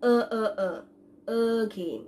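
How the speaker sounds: আ as a short throaty 'uh', then again; schwa demonstration. Shown as a demonstration. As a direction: drill আ as 'uh', like the start of again. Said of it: "উচ্চারণটা ঠিক কেমন হচ্ছে". (আ-কে again-এর অ্যা-ধ্বনির মতো 'uh' উচ্চারণ)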